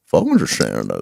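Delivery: put-on low, soft mumbling old bigot; mock old bigot. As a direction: grumble it like a confused old man. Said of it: "they're like".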